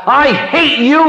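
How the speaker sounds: high, loud acted-out boy's yell; yelled outburst. Boy snapping at the girl in church, loud yelled outburst. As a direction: blurt it at full voice, voice leaping up. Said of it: "he yelled out".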